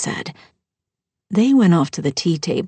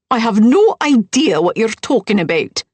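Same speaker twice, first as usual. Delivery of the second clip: louder, higher indignant stepmother; indignant denial; 5 semitones higher, 6 dB louder than usual. Acted. Stepmother brushing off the man-in-her-room question, loud and higher. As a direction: deny it flatly, loud and high.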